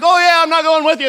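high, loud shout from shore; calling out. Acted. Jesus shoving the boat off without them, loud call. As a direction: call it after a departing boat.

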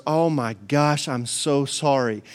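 low, slow, sheepish regret; mock contrite apology. Balaam sees the angel and apologises, sheepish and low. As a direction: apologise slowly and sheepishly, acting it.

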